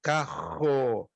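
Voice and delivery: "carro" with a throaty h-like R, kaho; carioca R. Shown as a demonstration. Teacher listing regions, then the carioca "carro". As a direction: say "carro" the Rio way, R breathed. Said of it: "Rio de Janeiro, Minas Gerais, Bahia, Nordeste".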